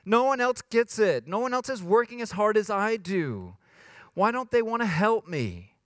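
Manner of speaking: high, whiny mock grumble; complaining. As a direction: complain like the only one working. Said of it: "complaining about others".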